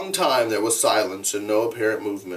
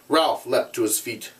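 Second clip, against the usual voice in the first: ordinary delivery, nothing marked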